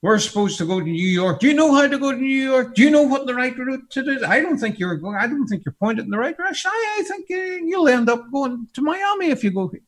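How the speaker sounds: quick, high-pitched nagging at the pilot; fussy backseat passenger. Pretend. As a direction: play a passenger doubting the pilot's route. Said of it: "go in to see the pilot and say".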